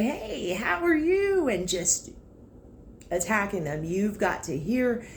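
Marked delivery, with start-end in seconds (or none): 0.0-1.5 chirpy sales greeting. Pretend.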